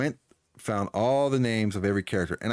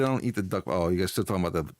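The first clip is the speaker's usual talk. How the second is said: ordinary talk, nothing marked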